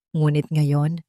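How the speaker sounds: usual speaking voice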